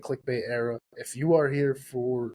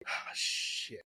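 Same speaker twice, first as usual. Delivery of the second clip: whispered curse; 12 dB quieter than usual. Retold or imitated. Reacting to clickbait era.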